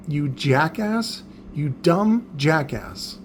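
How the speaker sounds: slow, deliberate scolding; drawn-out insult. Retold or imitated. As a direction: spell out the contempt slowly, word by word. Insults the imagined customer.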